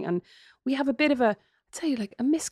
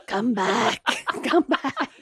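mock horror-film ghost calling, laughing; creepy Samara crawl; 2 semitones higher, much slower than usual. Sister hamming up a Ring ghost to stalk the guy. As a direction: croak it like the girl from The Ring. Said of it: "crawling along the ground like Samara from The Ring".

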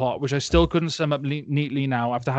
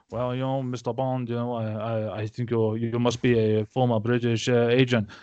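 lightly voiced villain addressing Bond; mock cartel boss; 3 semitones lower than usual. Cartel leader sizing up Bond, lightly mocked villain voice.

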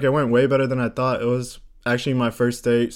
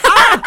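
very high, loud "Ah!"; high-pitched mock yelp; mock excited cry; 10 dB louder than usual. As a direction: imitate a shrieking burst of recognition. Imitates his excited reaction.

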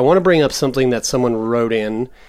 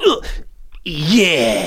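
satisfied groan; 4 semitones higher than usual. Retold or imitated. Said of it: "I go".

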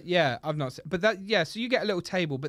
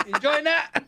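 high squeaky voice while laughing; 10 semitones higher, 5 dB louder than usual. Real, not acted. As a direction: giggle through the words. Laughing at imagined scene.

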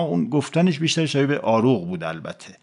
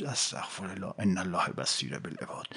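murmured, near breathless; weary hushed prayer; 6 semitones lower, 14 dB quieter than usual. Acted. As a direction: sigh it out under your breath, fed up. Weary man sighs a prayer. (استغفار آهسته و خسته)

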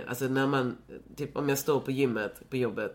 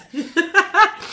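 loud, high burst of laughter; delighted shriek of a laugh; triumphant laugh; 14 dB louder than usual. Real, not acted. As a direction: crow with glee at winning.

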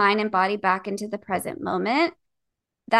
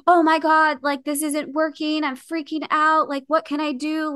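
high, frantic imitation of past panic; retold panic; 6 semitones higher than usual. To listeners, how she reacted to swollen joints, high mock panic. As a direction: replay her old panic attack. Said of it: "just total panic attack".

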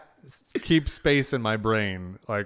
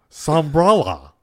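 loud, slow, high mock Boston drawl; Boston accent like he swallowed a Muppet; thick Boston accent; 5 semitones higher, 8 dB louder, slower than usual. Host imitating furniture man Elliot, exaggerated Boston accent. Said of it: "sounds like he swallowed a Muppet".

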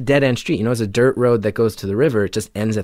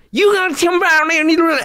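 mimicked yelling. Big truck driver yelling at the narrator, garbled high shout. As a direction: yell it like a furious local you can barely understand. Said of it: "he just starts yelling".

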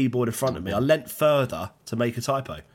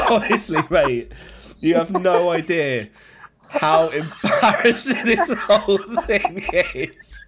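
laughing; 5 semitones higher, 4 dB louder, slower than usual. Real, not acted. Telling his mate while still laughing. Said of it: "laughing my head off".

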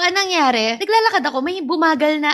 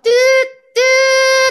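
horn toot imitation; 12 semitones higher, 7 dB louder than usual. Imitating garbage truck horn.

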